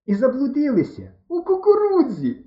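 high, loud crowing into a guffaw; uproarious drunken mirth; roaring with laughter. A narrator's reading. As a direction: crow it, then burst into helpless cackling. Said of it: "так реготав".

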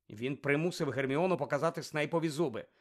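usual speaking voice

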